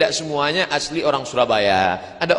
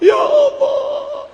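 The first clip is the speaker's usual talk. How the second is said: very high, drawn-out wail; acted weeping; much slower than usual. Pilgrim crying under the Ka'bah door, wailing. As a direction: wail it slowly like a man broken down. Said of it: "sedang menangis di bawah pintu Ka'bah".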